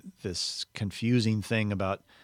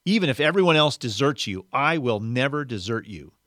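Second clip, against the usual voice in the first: louder, higher opening, easing slightly; emphatic declaration; 4 semitones higher, 6 dB louder than usual. A narrator's reading. Peter pledging loyalty to Jesus, emphatic declaration. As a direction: declare it boldly, as a reader voicing Peter. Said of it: "Well, Peter declared".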